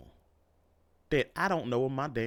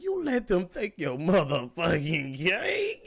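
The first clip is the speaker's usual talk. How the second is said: put-on drawn-out, teasing disbelief in his girlfriend's voice; slow, sarcastic jab from the girlfriend; mocking girlfriend; 4 semitones higher, slower than usual.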